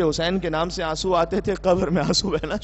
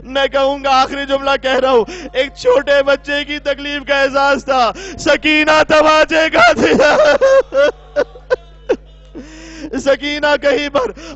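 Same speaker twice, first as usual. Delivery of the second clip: impassioned preaching; 5 semitones higher, 8 dB louder than usual. Acted. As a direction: hammer the last line home to the mourners. Preacher's climax at full force. (خطیب کا پورے جوش سے بلند، آہستہ نعرہ)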